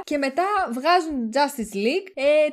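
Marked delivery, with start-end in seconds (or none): none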